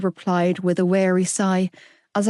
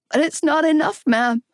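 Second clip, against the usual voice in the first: higher, raw tearful cry; a mild hoarse sob to her mother; crying hoarsely; 8 semitones higher than usual. Acted. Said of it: "I cried hoarsely".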